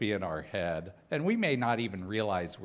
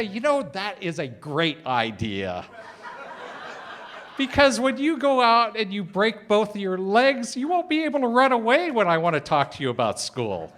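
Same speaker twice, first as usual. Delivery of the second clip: mock-approving fatherly sarcasm, building louder and higher; a dad needling his rollerblading teenage son with loud sarcasm; sarcastic dad; 9 semitones higher, 7 dB louder than usual. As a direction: praise the idea while meaning the opposite, laughing. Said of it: "I would use sarcasm".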